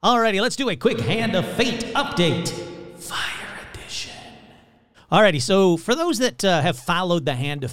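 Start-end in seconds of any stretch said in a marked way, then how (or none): 3.0-4.1 hushed, ominous whispered subtitle. Acted. Host announcing the Hand of Fate update's edition, whispered. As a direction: whisper it like an eerie episode title.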